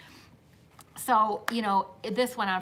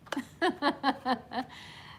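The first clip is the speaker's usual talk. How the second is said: long rippling laugh; 3 semitones higher than usual. Real, not acted. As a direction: let out a sustained chuckle. Laughing after finishing the story.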